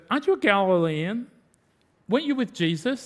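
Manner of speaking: lightly voiced servant girl pressing the question; accusing slave girl. Slave girl presses Peter about his accent, mildly accusing. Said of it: "this slave girl comes and goes".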